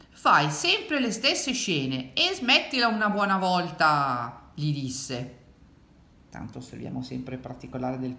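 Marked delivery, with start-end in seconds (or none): 0.1-4.5 higher, slow exasperated telling-off; drawn-out, fed-up rebuke; scolding grandmother. A narrator's reading. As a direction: scold a child who cries wolf, dragging the words. Grandmother scolds the boy in story.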